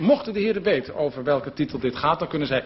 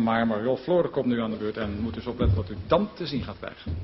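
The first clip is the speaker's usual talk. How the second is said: normal talking voice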